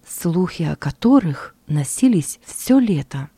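ordinary delivery, nothing marked

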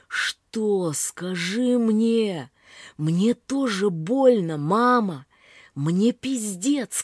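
desperate pleading. A narrator's reading. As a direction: beg her in despair. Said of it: "Отчаянно встряхиваю её". (отчаянная мольба к матери)

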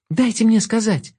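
high, urgent plea; pleading call. A narrator's reading. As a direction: plead to be heard. Girl pleads with wolf.

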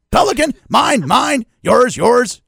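high, loud squawked words; gull-voiced basket call; mock seagull call. Mock three-point call.

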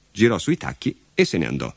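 ordinary delivery

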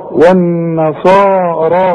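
والنصارى slow, with both long vowels equal; two even beats on صا and را; slow, even lengthening. Shown as a demonstration. Teacher models the even lengths of والنصارى. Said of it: "مدود طبيعية قد بعض".

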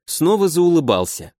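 ordinary delivery

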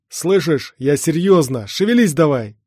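loud, insistent push to hurry; urgent shouting. Acted. Comrade under fire hurries the hero, urgent shout. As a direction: raise pitch and volume, demand action now. Everest urges hero during gunfight.

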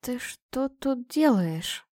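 very slow, sleepy hoarse mumble. A narrator's reading. Girl just woken questions man in her bed, hoarse mumble. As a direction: mumble it huskily, dragging the words. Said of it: "пробормотала я сипло".

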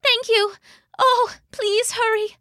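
very high, ailing voice with little coughs; sick and feeble. Acted. Sick Sif begging Thor to hurry, feeble high voice. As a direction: plead weakly, as if down with flu. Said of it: "Sif coughed".

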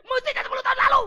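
hammed-up sobbing, high and loud; mock-tearful mourner glad at last; mock crying. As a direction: fake wail of a relieved mourner. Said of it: "orang nangis sembari bilang".

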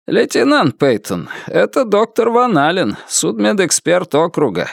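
distant voice through static; over the radio. A narrator's reading. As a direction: speak like a crackly radio. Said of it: "Голос его был невыразительным и далёким, прерываемым сильными помехами".

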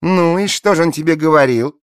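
high and loud, talking down; condescending. A narrator's reading. Blonde asking Alice condescendingly, high and loud. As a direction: ask as if humouring a child. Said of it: "снисходительно спросила Пергидрольная".

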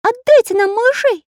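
very high, loud plea; pleading. A narrator's reading. Little artist begs pirates for the children, pleading. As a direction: beg in a piping little voice. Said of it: "умоляюще проговорил Карандаш".

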